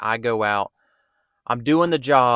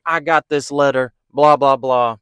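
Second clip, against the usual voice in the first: louder, dismissive mock rant; mock berating; 3 semitones higher, 7 dB louder than usual. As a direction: imitate her scolding, blah blah. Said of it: "she's immediately berating me".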